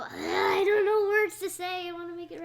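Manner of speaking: loud, high whining wail; frustrated whine. Real, not acted. Child pressed to perform an accent, loud whiny protest. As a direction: wail in frustration, near tears.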